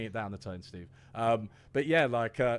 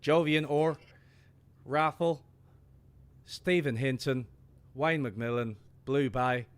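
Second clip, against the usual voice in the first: exaggerated Irish accent, higher and louder, calling out names; over-the-top Irish brogue for a live chat shout-out; hammed-up Irish accent; 3 semitones higher, 6 dB louder, slower than usual. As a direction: read the list like a flamboyant Irish host. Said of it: "your most egregious, flamboyant Irish accent".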